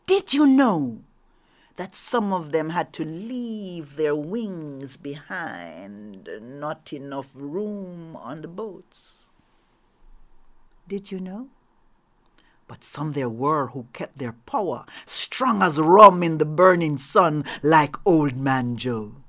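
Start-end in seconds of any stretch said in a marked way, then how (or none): 0.1-1.0 higher and louder, pointed question to the audience. A narrator's reading. Storyteller challenges audience. 15.0-19.2 louder, proud and forceful; emphatic. A narrator's reading. Emphatic story line.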